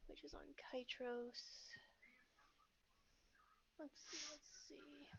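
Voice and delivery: slow, soft near-whisper; muttering to self. Real, not acted. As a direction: read your notes under your breath. GM reading notes to self.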